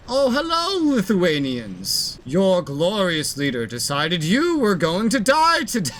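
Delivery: hammed-up dictator proclamation; ranting dictator speech. Repeats address, more exaggerated.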